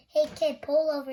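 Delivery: loud shout from the police officer; police yell. A narrator's reading. Police officer yelling at speeding Loretta, loud shout. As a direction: bark it out loud at the kid. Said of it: "and yelled".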